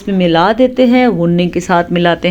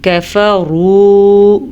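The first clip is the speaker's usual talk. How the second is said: slow, drawn-out tajweed chant; Quran recitation; much slower than usual. Shown as a demonstration. Teacher reciting for students. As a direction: intone the Arabic slowly.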